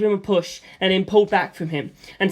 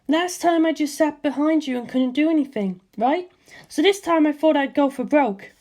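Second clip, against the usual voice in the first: put-on higher breezy young woman's voice; Lisa Lisa; 9 semitones higher than usual. Lisa Lisa reassuring George about her skirt, higher girlish voice. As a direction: toss off reassurance lightly, in a higher girl's voice.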